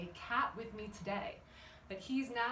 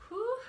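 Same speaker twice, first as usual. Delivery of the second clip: a bright, high "whew" of effort; high excited whew; 12 semitones higher, 6 dB louder than usual. Real, not acted. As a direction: let out a high, pleased whew. Exhaled cheer during warm-up.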